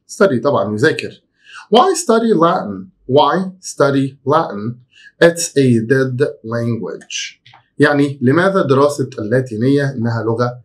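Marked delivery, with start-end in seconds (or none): 1.7-7.4 slow, careful example reading; slow model sentence. Shown as a demonstration. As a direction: read it slowly for students to follow. Teacher reads example slowly. (جملة مثال ببطء ووضوح)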